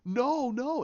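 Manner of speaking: high-pitched anguished denial, retold; dramatised cry of refusal; acted breakdown. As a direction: act out breaking down at bad news. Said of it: "she just completely breaks down".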